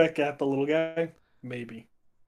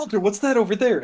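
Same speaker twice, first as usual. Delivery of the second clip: calling out; 6 semitones higher, 8 dB louder, faster than usual. Acted. Distracting Walter, calling out.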